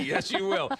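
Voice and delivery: laughing through the words, high and bright; real laugh breaking into the reply. Real, not acted. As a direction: burst out laughing as you agree. Host laughs at playful threat.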